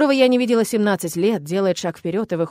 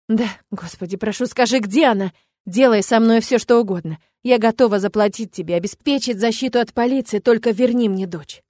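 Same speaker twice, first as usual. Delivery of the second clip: desperate begging; 3 semitones higher, 5 dB louder than usual. Acted. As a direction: beg with everything you have. Mother begs villain for daughter.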